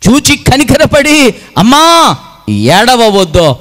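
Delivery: high, loud cry easing at the end; impassioned compassion. Acted. Jesus calls to the weeping mother, impassioned and loud. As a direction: call out fervently, drawing out the last word.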